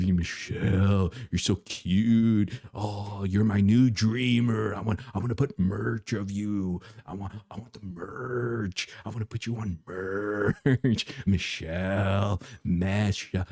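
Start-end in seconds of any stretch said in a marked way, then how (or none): none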